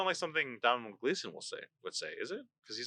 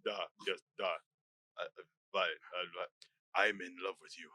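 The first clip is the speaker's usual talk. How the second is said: low, soft attempt at a Russian accent; hesitant, deep Russian-accented murmur; 9 semitones lower, 6 dB quieter than usual. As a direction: feel for a Russian accent, quietly. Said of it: "what's the Russian accent?".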